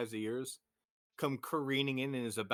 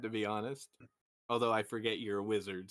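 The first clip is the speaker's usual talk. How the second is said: ordinary talk, nothing marked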